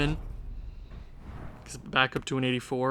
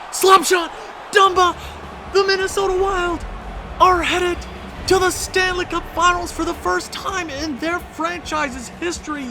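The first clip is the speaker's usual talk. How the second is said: much higher, louder broadcaster scream; ecstatic goal call; 13 semitones higher, 5 dB louder than usual. Real, not acted. Gamer calling his overtime series winner ecstatically.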